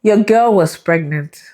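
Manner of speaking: slow, weighted reveal of pregnant; dramatic reveal. Real, not acted. Telling viewers the reveal, slow and emphatic. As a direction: land the big news slowly. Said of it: "guess what they saw".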